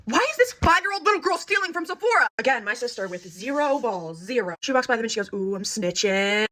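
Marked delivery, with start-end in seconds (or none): 0.0-2.3 high, loud, incredulous question; shocked disbelief. Retold or imitated. Sisters' shocked look voiced. 5.3-6.5 slow, drawn-out taunt; teasing tattletale. Retold or imitated. Sister teasing passing girls, acted.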